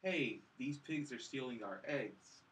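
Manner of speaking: usual speaking voice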